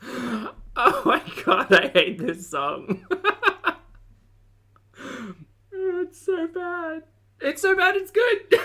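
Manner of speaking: high, slow, laughing groan at a bad song; real laughter at a terrible song; laughing disbelief. Real, not acted. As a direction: laugh through the words as if it hurts.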